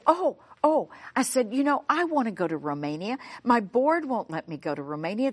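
normal talking voice

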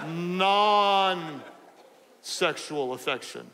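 "non-sexual affection" with NON drawn right out; real slow. Shown as a demonstration. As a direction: spell it out very slowly, stretching "non", pause before finishing. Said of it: "so I'm gonna say it real slowly".

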